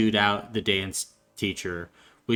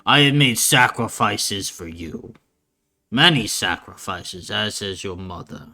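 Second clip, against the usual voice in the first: loud insistence easing into solemn reproach; gruff father; 6 dB louder than usual. Acted. Father pleads his sacrifices to his child, gruff and insistent. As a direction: drive home the sacrifice, then settle. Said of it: "you would hear a gruff voice go".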